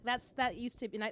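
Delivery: usual speaking voice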